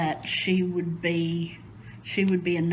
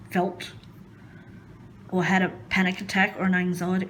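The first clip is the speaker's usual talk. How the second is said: usual speaking voice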